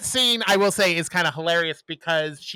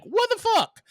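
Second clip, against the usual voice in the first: high, incredulous squawk; shocked outburst. Retold or imitated. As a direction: blurt out disbelief. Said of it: "her being like".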